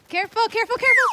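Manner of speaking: loud warning. Pretend. Calling warning during pretend chase.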